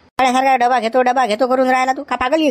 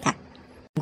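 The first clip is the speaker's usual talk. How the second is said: whispered hush; 15 dB quieter, much slower than usual. Acted. Uncle hushes the nephew before phoning, whispered. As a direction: whisper wait.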